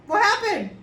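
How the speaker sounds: high, loud baffled question; bewildered. Retold or imitated. Asking yourself after the truth comes out, loud disbelief. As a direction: ask it in disbelief.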